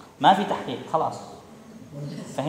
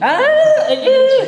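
surprised exclamation; 5 dB louder, much slower than usual. Real, not acted. Teacher exclaims at student.

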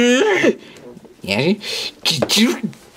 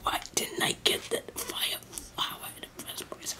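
whispered regret; 18 dB quieter than usual. Acted. Muttering to self.

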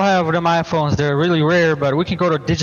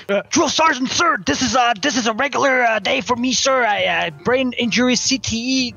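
higher, dim-witted private answering his sergeant; eager soldier; 5 semitones higher than usual. Acted. Soldier with brain injury answers his drill sergeant, eager and higher. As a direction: play a dopey recruit reporting for duty.

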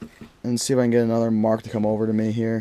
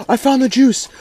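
excited; 12 semitones higher, 5 dB louder than usual. Real, not acted. Repeats line, second slower.

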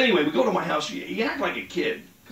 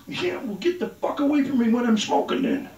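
ordinary talk

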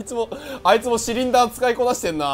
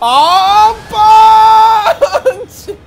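long drawn-out battle cry; screaming attack name; 10 semitones higher, 14 dB louder, much slower than usual. Acted. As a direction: scream the move name slowly.

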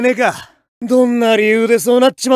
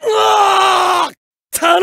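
long high drawn-out knockout scream; defeat scream; 8 semitones higher, much slower than usual. Acted. Fighter knocked out by the opponent, long falling scream. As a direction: cry out as you go down.

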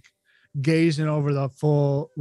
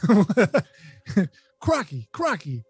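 high, fast Aussie showman voice; laughing through a bad Aussie accent; bad Australian accent; 8 semitones higher than usual. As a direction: ham up an Australian creature expert. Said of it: "I can't do any accents ever".

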